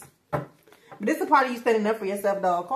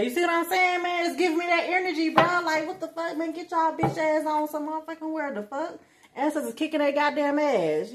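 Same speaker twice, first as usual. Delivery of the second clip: higher, fast, fired-up call-out; pumped-up street preacher energy; hyped rant; 6 semitones higher than usual. Real, not acted. Hyped rant at the spirit team.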